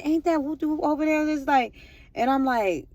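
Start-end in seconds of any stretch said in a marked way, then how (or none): 0.0-1.3 lightly imitated onlooker, higher pitch; bystander's voice. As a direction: raise the pitch a little for someone in line. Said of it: "somebody in the, in the line".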